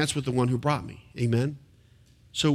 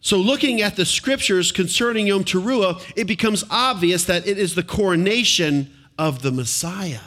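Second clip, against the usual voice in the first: ordinary delivery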